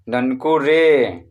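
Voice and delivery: slow Nanku रे with a lengthened रे; long-held रे. Shown as a demonstration. Teacher shows students how to say it, drawn out. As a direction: draw रे right out. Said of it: "इस तरह से".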